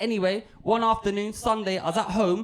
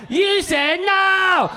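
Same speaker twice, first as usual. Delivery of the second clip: higher, drawn-out yell; louder, slow and insistent demand; demanding shout; 9 semitones higher, 6 dB louder, much slower than usual. Acted. Impatient texter demanding pictures from the comedian, shouted.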